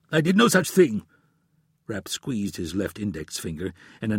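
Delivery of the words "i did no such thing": higher, louder denial; desperate denial. A narrator's reading. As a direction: deny it desperately. Omar denies.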